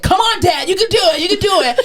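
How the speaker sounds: high-pitched imitation of pushy daughters; mock yelled encouragement. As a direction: egg Dad on in a high, insistent voice. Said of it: "women in this room yelling at this".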